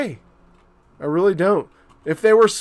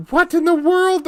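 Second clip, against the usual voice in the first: shocked disbelief; 12 semitones higher than usual. Real, not acted. Both units broke, shock.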